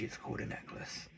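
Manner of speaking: whispered aside, breathy and hushed. Real, not acted. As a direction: lean in and whisper it. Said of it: "in child language".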